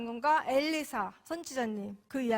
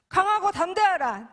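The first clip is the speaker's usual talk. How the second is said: loud, higher commanding call; commanding proclamation; 7 semitones higher, 8 dB louder than usual. Retold or imitated. Preacher voicing God's command, loud and commanding. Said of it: "계속 저에게 말씀하셨어요".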